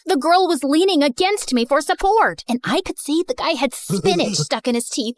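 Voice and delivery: usual speaking voice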